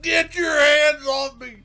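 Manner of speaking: high, slow, loud hammed-up shout; overacting mock. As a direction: ham up the shout, dragging it out. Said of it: "he's overacting like this guy".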